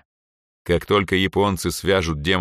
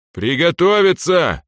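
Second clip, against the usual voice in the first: high, loud, drawn-out shout; 9 semitones higher, 6 dB louder, slower than usual. Acted. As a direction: bark the order across the field. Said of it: "крикнул Денис". (громкий протяжный крик)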